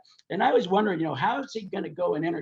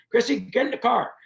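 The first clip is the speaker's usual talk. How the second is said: acted insistent order; 6 semitones higher than usual. [name] acted, ordering teller along.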